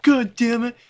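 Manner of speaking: high, whining imitation of a wounded enemy; mock whiny monster. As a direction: voice the hurt creature as a crybaby. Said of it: "He's like".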